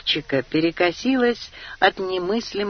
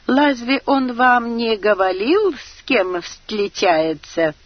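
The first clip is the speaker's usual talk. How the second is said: put-on high, l for r, Chinese accent; Chinese-accented question; Chinese accent Russian; 6 semitones higher, 4 dB louder than usual. A narrator's reading. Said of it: "китаец".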